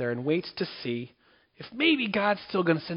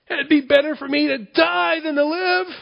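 much higher and louder, wailing wish to die; despairing Jonah; 13 semitones higher, 8 dB louder than usual. Acted. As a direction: moan it like a sulking prophet begging for death. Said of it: "He wanted to die and said".

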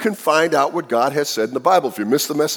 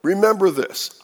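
normal talking voice

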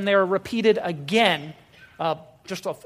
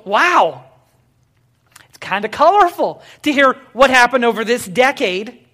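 ordinary talk

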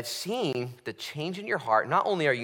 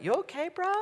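concerned friend. Pretend.

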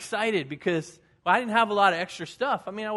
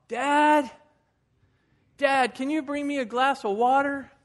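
put-on higher, louder child voice calling down the hall; little boy calling; 6 semitones higher, 4 dB louder, slower than usual. Boy in bed calling his dad for water, high pleading child voice.

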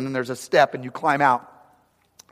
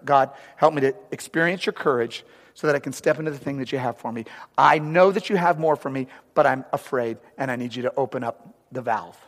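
soft, low, intimate prayer; quiet prayer; 5 semitones lower, 6 dB quieter than usual. Acted. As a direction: pray quietly and humbly. Model prayer for the congregation.

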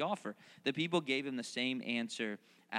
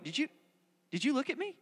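ordinary talk

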